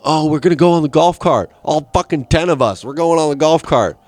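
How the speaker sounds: mocking older kids. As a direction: ham up the show-off friends. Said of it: "And they all".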